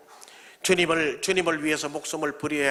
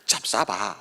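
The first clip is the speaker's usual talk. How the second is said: Sangju dialect; 10 semitones lower, slower than usual. Retold or imitated. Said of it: "상주 표현으로 하면".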